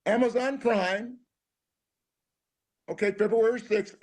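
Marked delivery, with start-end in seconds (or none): 0.0-1.2 slow drawn-out promo read; announcer plug. Acted. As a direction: sell it like an announcer. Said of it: "slow announcer plug".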